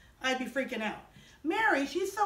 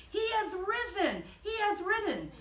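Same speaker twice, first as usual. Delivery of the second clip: joyful proclamation; 6 semitones higher than usual. Acted. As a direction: proclaim the good news in a high voice. Mary proclaims to the apostles.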